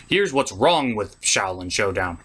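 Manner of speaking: normal talking voice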